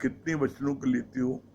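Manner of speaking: ordinary delivery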